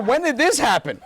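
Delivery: high, loud alarmed question; mock panic. Husband questioning his pregnant wife, mock panicked. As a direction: play a husband in comic shock.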